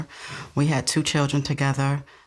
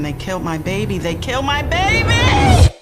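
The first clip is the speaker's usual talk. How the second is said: builds from a raised cry to a full high scream; retold screaming; 7 semitones higher, 6 dB louder than usual. Mother reliving her call for help, screaming. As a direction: cry it out, rising into an anguished scream. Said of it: "I'm screaming and I'm screaming".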